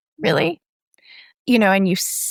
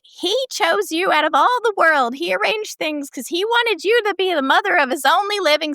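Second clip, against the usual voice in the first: higher, a hint of a matter-of-fact old witch; 10 semitones higher than usual. Retelling the film, narrator voices Minnie telling Rosemary her fate. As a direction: tell her plainly like a smug old neighbour. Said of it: "She's like".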